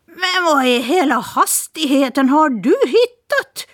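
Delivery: surprised exclamation. Acted. Tomte woman astonished at Polly's guest, exclaiming. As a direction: blurt it out in astonishment. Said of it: "utbrast hon".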